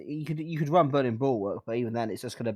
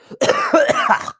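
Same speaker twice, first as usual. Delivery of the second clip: loud hacking cough; harsh coughing fit; 12 dB louder than usual. Real, not acted. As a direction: cough hard, real and rough. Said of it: "That sounded very healthy".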